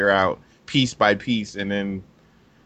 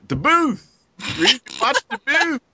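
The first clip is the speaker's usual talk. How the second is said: excited shout; 13 semitones higher, 5 dB louder than usual. Retold or imitated. Friend urging him to watch.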